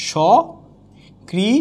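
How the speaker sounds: সকৃতজ্ঞ drawn out slowly, syllable by syllable; teacher spelling out সকৃতজ্ঞ slowly; slow demo pronunciation. Shown as a demonstration. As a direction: stretch সকৃতজ্ঞ out slowly for the class. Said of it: "teacher demonstrates pronunciation".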